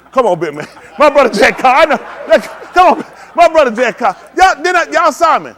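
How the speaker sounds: loud, high hype-man shouting. Acted. Preacher calling out the volunteer's name to the congregation in loud high hype. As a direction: hype up the room at full volume.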